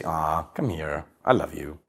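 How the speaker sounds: slow, soft and low, calming; soothing. Pretend. Grounded man soothing upset partner, slow soft tender voice. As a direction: gently draw her in, unhurried and hushed.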